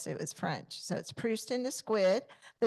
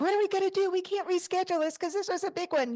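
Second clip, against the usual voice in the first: panicked worry; 10 semitones higher, faster than usual. Retold or imitated. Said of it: "I was like".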